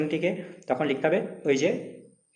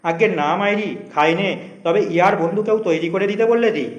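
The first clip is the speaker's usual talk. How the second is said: denying it; 5 semitones higher, 6 dB louder than usual. Acted. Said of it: "মাথা নাড়িয়া অস্বীকার করিয়া বলিল".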